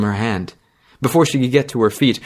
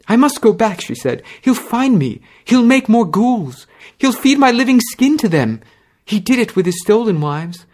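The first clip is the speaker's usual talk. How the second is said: terrified; 13 semitones higher, 4 dB louder than usual. Acted. Terrified girl fearing husband.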